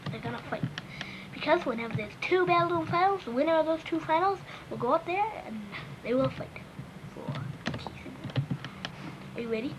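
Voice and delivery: ordinary talk, nothing marked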